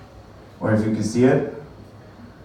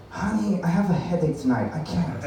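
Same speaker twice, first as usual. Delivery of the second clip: softly voiced mock excuse; feeble excuse; 3 semitones higher, 6 dB quieter than usual. Spouse turning down partner at bedtime, soft and feeble. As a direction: beg off quietly, trailing away.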